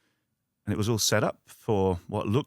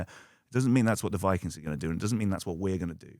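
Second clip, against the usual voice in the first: ordinary delivery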